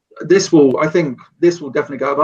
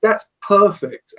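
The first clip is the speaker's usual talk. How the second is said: delighted; 6 semitones higher than usual. Retold or imitated. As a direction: gush as if it's exactly what you pictured. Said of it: "Dusan was like".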